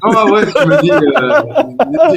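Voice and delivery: high, laughing reply; laughing through words. Real, not acted. Admits what he thought while laughing. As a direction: let the laugh break into the words.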